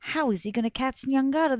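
usual speaking voice

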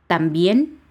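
"también" stressed on BIÉN, rising; stress on last syllable. Shown as a demonstration. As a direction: lean hard on the é of "también". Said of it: "ahí se acentúa en la E".

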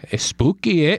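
mock Canadian lilt on spooky eh; hammed-up Canadian, a touch far from his own voice; Canadian accent. Laughing host redoes the ghost quote in a Canadian accent. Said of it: "that was Canadian".

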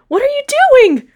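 a panicked female shriek; shrieking alarm from a distance; shriek from afar. A narrator's reading. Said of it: "a female voice shrieked from afar".

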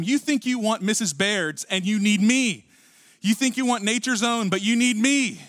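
insistent, emphatic preacher's retelling; adamant. Retold or imitated. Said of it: "he's so adamant about it".

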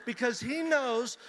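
slow, loud, emphatic preaching. Real, not acted. Preacher explaining a father's blessing, emphatic preaching. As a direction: land each phrase with weight.

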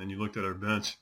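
ordinary talk, nothing marked

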